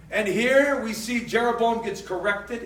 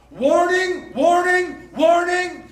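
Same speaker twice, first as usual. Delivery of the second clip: loud warning; 6 semitones higher, 4 dB louder, much slower than usual. Acted. Preacher sounds repeated alarm.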